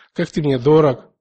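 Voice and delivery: ordinary talk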